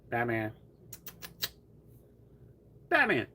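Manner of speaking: very high sing-song pet call; calling the cat. Real, not acted. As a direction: coax the cat over.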